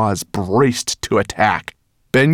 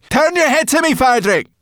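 high, loud command; urgent cry; 5 dB louder than usual. A narrator's reading. Sorrel to Firedrake from his neck, urgent high cry. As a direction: yell the order high and urgently. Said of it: "she cried, wriggling down".

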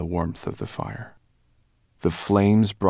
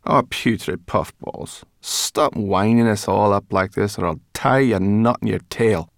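irritated brownie; 2 semitones higher, 5 dB louder than usual. A narrator's reading. Brownie figure threatens rat.

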